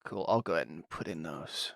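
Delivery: muttered whisper to self. Real, not acted. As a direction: whisper it under your breath while busy. Muttering to self while typing.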